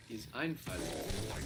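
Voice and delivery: Einfalt stretched out slowly, German vowels; slow German word. Shown as a demonstration. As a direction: say Einfalt slowly, AIN-falt. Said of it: "the word for simple-mindedness".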